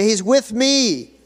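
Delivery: loud, high, drawn-out declaration; ringing preacher's prompt; congregational call-out. Shown as a demonstration. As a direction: call it out slowly for everyone to repeat.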